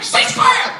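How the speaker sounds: high, loud breathy shout through laughter; yelled call. Retold or imitated. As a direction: call it across the battlefield. Calling ceasefire mid-fight.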